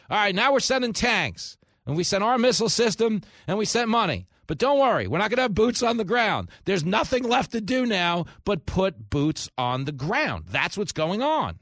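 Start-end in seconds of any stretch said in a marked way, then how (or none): 0.1-10.4 higher, louder, falsely soothing; mock reassurance. Host mocking Washington's promises about Ukraine, sarcastic reassurance. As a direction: sarcastically parrot the official line. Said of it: "Then it was".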